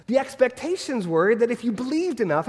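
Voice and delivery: ordinary talk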